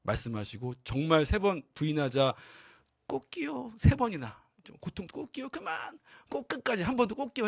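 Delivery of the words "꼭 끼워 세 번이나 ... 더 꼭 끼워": high, thin rooster squawk of words; rooster crowing voice. Retold or imitated. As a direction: crow it out like a mocking rooster. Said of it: "해가지고". (높고 가는 수탉 울음소리 흉내)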